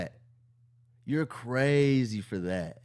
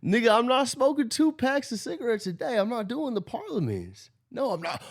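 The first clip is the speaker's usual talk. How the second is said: lightly voiced mockery of the host; mock impression; 9 semitones higher than usual. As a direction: ham up his voice to tease him. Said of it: "You can't imitate it".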